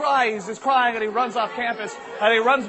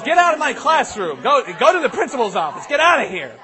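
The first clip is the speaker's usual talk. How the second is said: raised, higher-pitched furious ordering; angry teacher; 5 semitones higher, 6 dB louder than usual. Acted. Teacher throws pupil out of class, angrily. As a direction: throw the kid out, loud and high. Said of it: "and she says".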